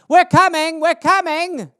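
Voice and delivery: high calling-out fly voice; mock Paul Revere cry. A fruit fly warning the others, high and calling. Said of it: "the Paul Revere fruit fly who's like".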